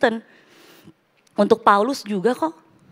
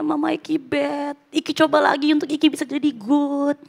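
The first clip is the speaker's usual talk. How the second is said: put-on higher, pleading little boy voice; lightly voiced sobbing son begging his mother; imitating crying child; 6 semitones higher, 3 dB louder than usual. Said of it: "Oh, dia nangis".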